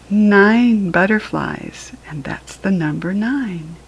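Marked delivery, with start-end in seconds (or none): none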